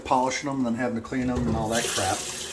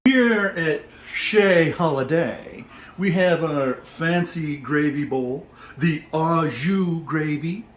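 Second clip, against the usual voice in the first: slow, drawn-out fancy restaurant presentation; mock-fancy host; 3 semitones higher, much slower than usual. Home cook showing off a gravy bowl like a restaurant host, mock-fancy. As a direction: present it grandly, playing at posh dining.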